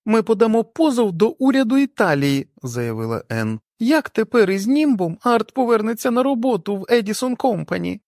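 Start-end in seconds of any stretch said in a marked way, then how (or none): 0.1-2.5 higher voice of an outraged wife, declaring; the wife Ann voiced as she vows to sue; indignant wife. A narrator's reading. Wife threatening a lawsuit, indignant higher voice. 3.8-8.0 higher voice of an outraged wife, declaring; the wife Ann voiced as she vows to sue; indignant wife. A narrator's reading. Wife threatening a lawsuit, indignant higher voice.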